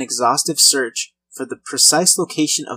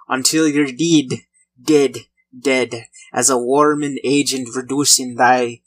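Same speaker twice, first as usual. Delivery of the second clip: light mock Irish pirate voice passing a death sentence; pirate Irish accent. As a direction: ham up a frontier judge in a half-Irish, half-pirate brogue. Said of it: "somewhat pirate Irish accent".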